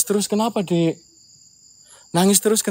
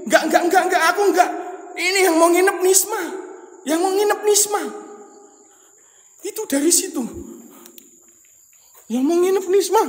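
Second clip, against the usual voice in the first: panicky refusal; 12 semitones higher, faster than usual. Real, not acted. Panicked refusing, voice jumps high.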